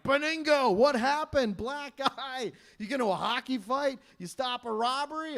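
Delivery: high, eager volley of questions; mock excited friends. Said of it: "All my friends were excited".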